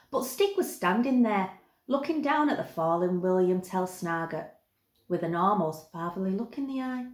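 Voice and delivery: ordinary delivery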